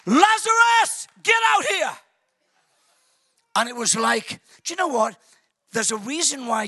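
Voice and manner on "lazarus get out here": Jesus shouting Lazarus out of the tomb; loud shout. Acted. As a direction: bellow his name at the grave. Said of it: "He shouts in a loud voice".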